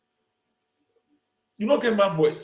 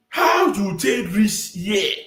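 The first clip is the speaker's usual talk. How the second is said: very slow, drawn-out incredulous question; exaggerated demand; 3 semitones higher, much slower than usual. Commentator asking Obi how he got here, slow exaggerated demand. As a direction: stretch each word of the challenge. Mock confrontation, first take.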